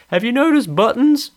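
high, mocking comic voice; sneering comedian parody; mock stand-up comic. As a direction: send up a hack stand-up line. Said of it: "It's just like".

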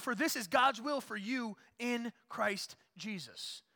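loud, high and slow, each word weighed; slow climactic preaching. Acted. Pastor proclaiming God's will to the church, slow loud preaching. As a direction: land the verse like a verdict.

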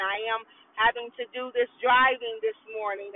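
ordinary delivery

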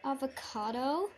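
normal talking voice

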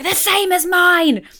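high, slow mock shout; shouting back. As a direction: yell it back, drawn out. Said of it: "other people shouting back".

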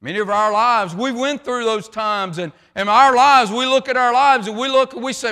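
loud, high, driving sermon voice; full-force preaching. Acted. Preacher rousing the congregation, loud and driving. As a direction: preach it out over the crowd.

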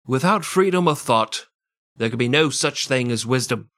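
hammed-up Australian accent quote; hammed-up Australian reading. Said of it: "I don't know why I'm Australian".

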